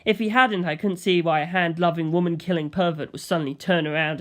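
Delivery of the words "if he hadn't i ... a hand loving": ordinary delivery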